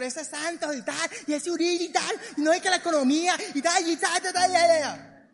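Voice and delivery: hammed-up grumbling old person; old-timer grumbling. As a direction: rant like an older person about politics. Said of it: "hablar como viejo, como gente ya mayor".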